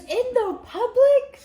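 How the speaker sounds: high, slow, shocked squeak on "In the public?"; freaking out. Retold or imitated. Scene: the prince shocked he'd kiss her in public, panicked squeak. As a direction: play him panicking and scandalised. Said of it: "he's freaking out".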